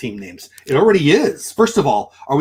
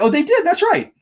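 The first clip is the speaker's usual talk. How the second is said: sudden high heated outburst, much higher; voice got hot; 3 dB louder than usual. Real, not acted. Streamer heating up over the Rangers history. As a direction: voice jumps high and hot, caught up in it. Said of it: "Why did my voice get hot?".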